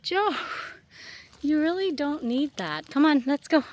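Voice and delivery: normal talking voice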